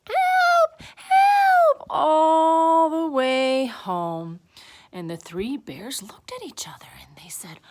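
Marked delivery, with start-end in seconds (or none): 0.1-1.9 very high, loud shriek for help; screaming. A narrator's reading. Goldilocks fleeing the three bears, panicked scream. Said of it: "and she screamed". 1.9-4.4 high, loud, long-drawn words; wailing narration. A narrator's reading. Goldilocks running down the stairs, drawn-out high narration.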